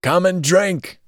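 high-pitched drunken roar, acted; hoarse roar. A narrator's reading. Drunkard summons his associate to drink, roaring. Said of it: "As he horselessly roared this out".

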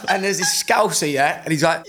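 normal talking voice

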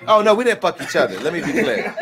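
laughing while clarifying; laughing denial. Real, not acted. Host clears up a misunderstanding about Rob, laughing. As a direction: protest through the laughter.

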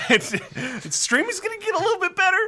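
high laughing promise. Real, not acted. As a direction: giggle through the claim.

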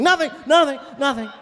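high, hurried mock-panicked denial; caught in the act. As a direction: blurt it out like a caught kid. Caught by Hope Hicks in skit.

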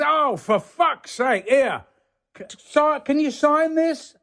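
high-pitched lorry driver voice; imitating star-struck driver. Lorry driver asks an actor for an autograph, star-struck imitation. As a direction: act out an excited fan's request, pitched well up.

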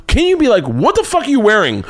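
high, loud incredulous outburst; exasperated. Real, not acted. Comic ranting about his oblivious mom, high and loud. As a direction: let the disbelief burst out high and loud. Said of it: "exasperated rant at mom".

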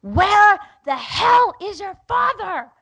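slow, high, loud yell; furious scream. Retold or imitated. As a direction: lose it and scream at the kids. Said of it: "Just scream it to them".

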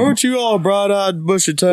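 high-pitched talk-show host impression; Doctor Phil. Host greeting a guest, high mock impression. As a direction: pitch it well up, mocking a folksy host. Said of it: "he talks like this".

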